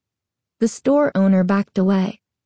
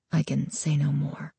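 softer, weary whisper; 5 semitones lower, 8 dB quieter than usual. A narrator's reading. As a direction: hush it, almost a whisper. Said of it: "he repeated in a whisper".

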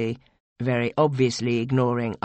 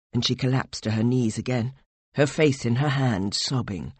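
usual speaking voice

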